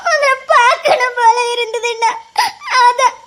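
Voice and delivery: tearful half-laugh; crying and laughing. Acted. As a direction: smile through the tears. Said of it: "எதுக்கு அழுற?". (அழுகையும் சிரிப்புமாக)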